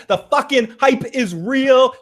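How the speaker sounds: high, slow hype-man call; hyped-up announcing. Acted. Podcast host hypes the live chat, slow high hype call.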